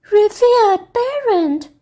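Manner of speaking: a sweet, dutiful daughter addressing her father; princess voice. A narrator's reading. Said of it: "she said".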